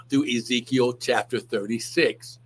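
usual speaking voice

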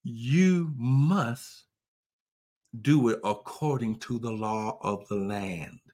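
usual speaking voice

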